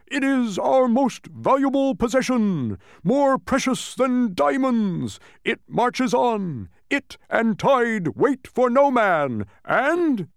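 high, loud, slow oration; orator's drawn-out shout; impassioned shouting. A narrator's reading. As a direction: proclaim it grandly, stretching every word. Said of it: "shouting with his arms outstretched".

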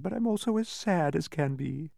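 high, plaintive sighing voice; sad sigh. A narrator's reading. Man with head in his hands, sad sigh. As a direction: sigh it out sorrowfully. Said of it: "and sighed".

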